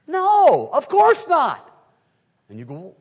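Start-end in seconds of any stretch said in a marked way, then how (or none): 0.1-1.7 emphatic shout. Acted. Emphatic denial.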